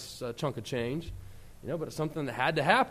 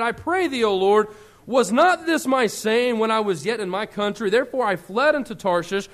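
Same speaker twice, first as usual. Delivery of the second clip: raised, declaiming Bible reading; scripture reading; 4 semitones higher, 7 dB louder than usual. Acted. Preacher reading Jonah's prayer, declaiming. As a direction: read the verse with fervor.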